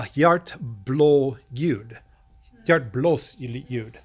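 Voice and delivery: Hjartblåsljud, slow deliberate Swedish pronunciation; Swedish word. Shown as a demonstration. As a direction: sound out Hjartblåsljud the Swedish way, unhurried. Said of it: "A heart murmur".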